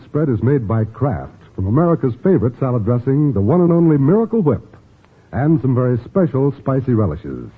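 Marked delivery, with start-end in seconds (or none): none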